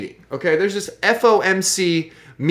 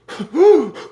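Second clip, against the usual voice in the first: mock gasp; 8 semitones higher, 4 dB louder than usual. Mock crack addict gasp.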